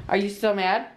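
ordinary talk, nothing marked

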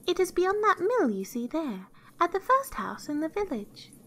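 put-on high-pitched innocent child; little girl. A narrator's reading. Girl gives the wolf directions, high and innocent. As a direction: pitch it well up, like a helpful girl.

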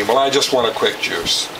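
normal talking voice

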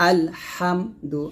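আলহামদু slowly, ha pressed out from mid-throat; slow syllable-by-syllable আলহামদু with a throaty হা; deep throaty ha. Shown as a demonstration. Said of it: "এরকম".

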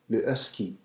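husky with almost no H, le ɛski; French husky. Shown as a demonstration. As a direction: drop the push on the H in husky. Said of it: "not as much push to the H".

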